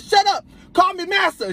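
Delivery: high, slow, bossy mock command; mock commanding mistress. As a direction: order him like a stern master. Girlfriend in role play commanding.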